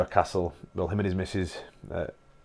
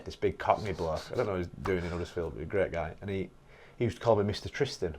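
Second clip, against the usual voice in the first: ordinary talk, nothing marked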